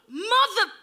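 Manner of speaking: high-pitched cut-off curse; livid. Retold or imitated. Runner retelling her reaction as he walked off, livid. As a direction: start an outraged swear and stop short. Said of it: "I was so livid".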